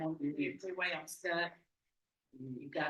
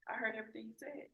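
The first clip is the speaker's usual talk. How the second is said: ordinary talk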